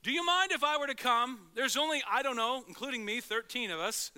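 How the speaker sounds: loud and high, overly polite asking; loud, high, mock-hesitant politeness; mock timid request. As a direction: ham up a fussy polite guest. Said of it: "he doesn't say".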